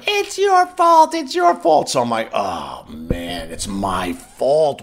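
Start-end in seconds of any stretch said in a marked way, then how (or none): 0.1-1.9 very high, drawn-out screech; imitated yelling woman. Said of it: "this lady's yelling". 2.3-4.8 retold sinking dismay.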